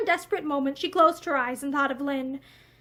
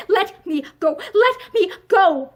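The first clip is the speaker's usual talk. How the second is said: louder, rising cries to be let go; frightened plea; 3 semitones higher, 5 dB louder, slower than usual. A narrator's reading. Wife struggling against her husband, frightened. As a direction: beg to be freed, scared.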